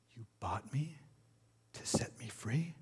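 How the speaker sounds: hushed, incredulous question; disbelief. A narrator's reading. Freed woman to her buyer, quietly disbelieving. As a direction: ask it softly, rising in wonder. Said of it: "she said in disbelief".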